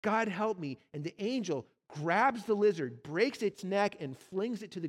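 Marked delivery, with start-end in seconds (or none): 0.0-0.8 higher, louder desperate cry; ghost cries out. Acted. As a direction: give in at last, crying out to God. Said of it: "the ghost cries out".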